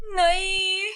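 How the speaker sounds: sudden high cry of no; a high, startled shout; anguished cry. Acted. Father sees the chandelier fall on Mahi, crying out high.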